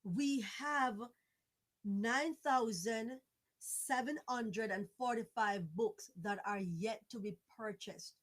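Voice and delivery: ordinary talk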